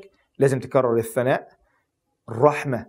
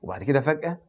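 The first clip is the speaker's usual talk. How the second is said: ordinary delivery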